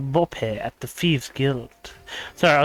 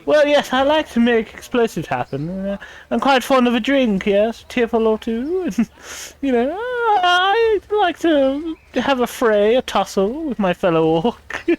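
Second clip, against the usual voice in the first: higher, refined posh voice with a laughing crack; posh well-spoken orc; 8 semitones higher than usual. Pretend. Streamer voicing her orc in Orcish, higher posh refined voice. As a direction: play the orc as a proper posh gentleman. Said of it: "she's, like, really posh and well-spoken".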